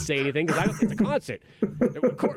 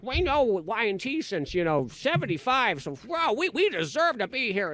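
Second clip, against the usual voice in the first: higher-pitched mocking lady voice, swooping; territorial fan ladies; 6 semitones higher than usual. Ladies at the concert defending their spot, territorial. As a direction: imitate huffy, possessive fans, pitched up.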